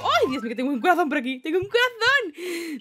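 excited. Real, not acted. Heart icon appears in game.

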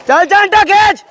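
high loud shouted cheer. Real, not acted. Cheering Chintu during the 200 metres, loud shout. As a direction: yell Chintu down the pool.

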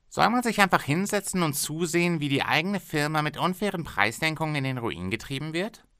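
a pushy boss bargaining hard; haggling factory owner. Acted.

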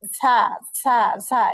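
ঝা with a light bird-like chirping hiss; chirpy zay sound; thin hissing za. Shown as a demonstration. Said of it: "পাখির মতো একটা চিচি আওয়াজ".

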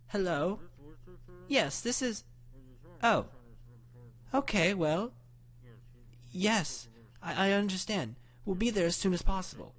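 high-pitched grandmother voice; grandma on the phone. A narrator's reading.

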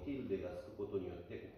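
normal talking voice

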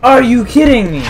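furious gamer outburst; exasperated shout. Real, not acted. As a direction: yell it at the game in frustration. Gamer killed again, exasperated.

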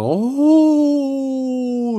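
long, high, loud cry of amazement; drawn-out cry. Real, not acted. Pulling a stunning card, long high excited cry. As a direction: stretch the oh as the card is revealed.

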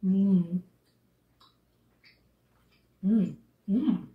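speaking between bites; chewing while talking. Real, not acted. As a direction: mouth partly full. Seen 0: Woman bit piece earlier, mouth working while holding half-eaten chocolate.

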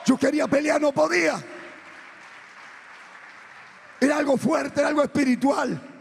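testimonio proclamado, agudo y fuerte; prédica a toda fuerza. Real, not acted. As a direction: alza la voz como predicador encendido. Preaching at full force. (prédica intensa, casi a gritos)

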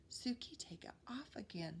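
hushed, voiceless whisper of the rhyme; whispered rhyme line. Shown as a demonstration. Teacher whispers the rhyme line to the class, hushed. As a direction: whisper it like a secret.

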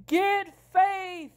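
very high, loud yell; hammed-up labor-pain scream of an order; acted shouted order. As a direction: shout a desperate command. Woman in labor yells an order.

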